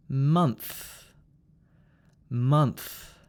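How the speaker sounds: "month" ending in n plus breathy th; NTH ending. Shown as a demonstration. Teacher models a common word, "month" with n plus th. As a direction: push th out after n in "month". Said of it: "do the N T H sound".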